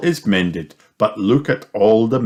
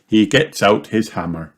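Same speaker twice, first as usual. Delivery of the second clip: ordinary delivery, nothing marked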